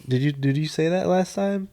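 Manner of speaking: normal talking voice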